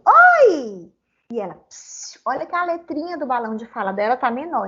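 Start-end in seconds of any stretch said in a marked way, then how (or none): 0.1-0.9 high, loud drawn-out call; shouting hello. Acted. Felipe arrives at Mafaldinha's house and greets her, shouting. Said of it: "falou gritando". 1.7-2.2 very soft, voiceless shushing; a quiet "psiu" to hush someone; hushing psst. Acted. Mafaldinha shushes Felipe on arrival, hushed psst. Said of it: "ela tá falando mais baixo do que ele".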